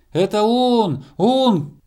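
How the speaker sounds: high, excited loud call; squirrel shouting. A narrator's reading. Squirrel on star identifying hare, high shout. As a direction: shout confirmation down from above. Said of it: "крикнула со звезды белка".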